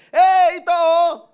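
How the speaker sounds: high, loud, drawn-out accusing shout; announcing call-out. Acted. Bystander points out Peter to the others, loud call. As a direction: call the whole crowd over to look. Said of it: "in-announce na nung isa eh".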